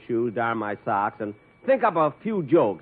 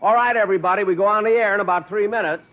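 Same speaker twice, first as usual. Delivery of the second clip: loud, higher call to the whole cast; 7 semitones higher, 5 dB louder than usual. Acted. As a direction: announce it to everybody.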